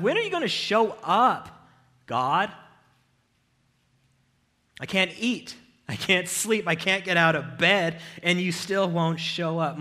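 loud, high desperate plea to God; shouting a prayer of despair; anguished cry. Acted. Voicing the psalmist's desperate cry.

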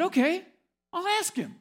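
high, loud little voice, bright and eager; mock chirpy student agreeing; high quirky student. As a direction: squeak it out like the odd student. Odd student voiced in story.

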